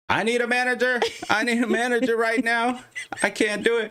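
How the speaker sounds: high, squeaky panic; mock-panicked squeal pleading for a manager; panicked inner voice. Imitating her panicking brain, high squeaky plea for a manager. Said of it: "her brain was like".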